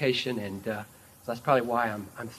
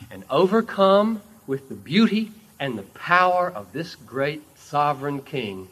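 ordinary talk

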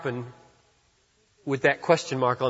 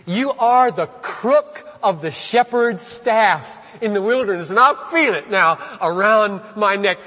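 worshipful exclamation; 8 semitones higher, 6 dB louder than usual. Acted. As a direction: lift it up in worship. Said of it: "they begin to say".

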